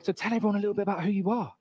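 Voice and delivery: a hint of a teacher coaxing a pupil; teacher prompting. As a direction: mimic a teacher's quick, high-pitched prompt. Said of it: "and she's like".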